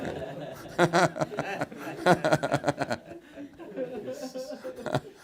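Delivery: hearty laugh. Real, not acted. Laughs at own joke.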